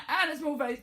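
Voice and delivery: high, tiny voice for a small face. Shown as a demonstration. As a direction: pitch up small.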